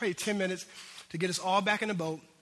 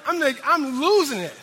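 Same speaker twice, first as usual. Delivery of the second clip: normal talking voice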